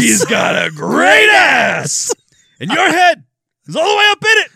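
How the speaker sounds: loud gung-ho soldier. Retold or imitated. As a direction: roar it like a crazed colonel. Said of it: "quoting Al Pacino".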